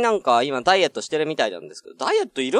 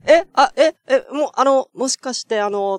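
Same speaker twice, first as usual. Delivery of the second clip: high startled "え？" repeated, louder; flustered surprise; 10 semitones higher, 3 dB louder than usual. Retold or imitated. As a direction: caught off guard, stammering. Same flustered reaction retold. (驚いて戸惑う高い声)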